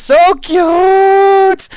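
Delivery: very high, loud, long-held squeal; gushing squeal. Acted. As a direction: shriek with mock adoration. Acting out a gushing chat message.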